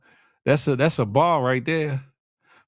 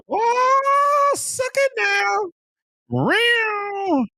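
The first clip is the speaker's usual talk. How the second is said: high, drawn-out squealing reaction; excited squeal; much slower than usual. Real, not acted. Host squeals at a chat comment, high and excited. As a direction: squeal it out in delight.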